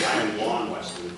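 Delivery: normal talking voice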